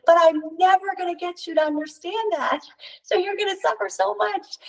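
a sage weeping for his students; high tearful plea; Yogananda crying. Retold or imitated. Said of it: "He's like".

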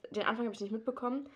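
ordinary delivery

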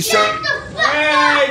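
put-on high, slow child's outburst; little boy voice. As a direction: imitate a rude kid, high. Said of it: "what did that little boy say".

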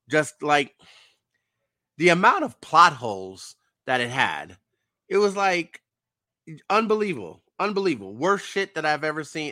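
can't believe how bad it was; 1 outraged disbelief. Real, not acted. Peak of the finale rant.

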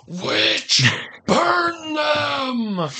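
high mock mob shout, laughing. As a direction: yell like a witch-hunting villager. Mock witch hunt outcry.

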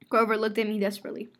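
ordinary delivery, nothing marked

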